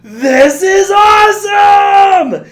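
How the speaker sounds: very high, drawn-out exultant shout; Peter's thrilled scream. Retold or imitated. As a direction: yell it long and loud, terror turned to joy. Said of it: "he starts screaming over the first loop".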